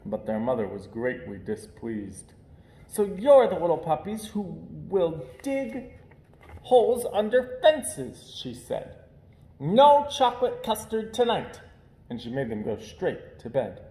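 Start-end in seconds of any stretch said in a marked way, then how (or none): 2.9-8.3 scolding mother. Acted. Mother scolds puppies again. 9.6-11.6 a firm, higher mother's rebuke; stern mother. Acted. Mother punishes puppies.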